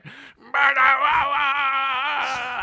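high, loud wailing plea; desperate shout. Retold or imitated. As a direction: cry out like a greedy fan. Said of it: "And I was always like".

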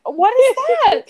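high, loud baffled question. Retold or imitated. Friend asking what Macca's is, baffled and high.

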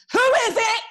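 "Who is it?" barked high and loud; militant voice. Retold or imitated. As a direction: challenge whoever is at the door. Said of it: "I've gotta put on that militant voice".

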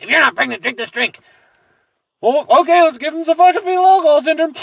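high, loud and fast sarcastic yell; mocking shout. Mocking the daughter's reply, high loud fast yell. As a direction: shout it in outraged mockery. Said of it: "have this drink".

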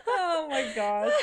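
slow words out of a laughing fit. Real, not acted. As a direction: say it slowly, still laughing.

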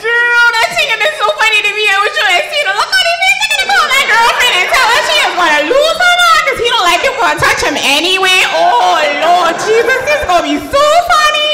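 high, fast breathless gossip; hammed-up excited gushing in a high voice; nurse, imitated. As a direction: rattle it off high, tickled to bits. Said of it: "And she goes".

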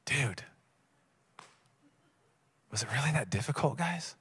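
low, soft, sleepy grumble; sleepy Jesus. Acted. Sleepy Jesus to his disciples, drowsily unimpressed. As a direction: mumble it wearily under your breath. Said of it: "He's kinda like sleepy Jesus".

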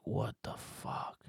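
slow, breathy whisper of wonder; realizing in a slow whisper; awed whisper. Retold or imitated. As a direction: draw it out, hushed and amazed. Epiphany inner reaction.

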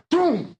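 high "boom" for a hurled chair; imitated throwing noise. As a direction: voice the throw as a sound effect.

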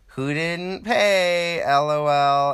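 high, loud, slow "who didn't pay"; mock outraged shout. Diner at the bill's end calling out the table, loud and high. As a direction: belt it at the table in disbelief. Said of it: "you're like".

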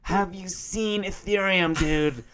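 loud, high, strangled American dude voice; mock an overexcited American crypto bro; American bro accent impression. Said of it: "sounds like a, a, a kid".

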